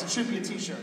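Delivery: usual speaking voice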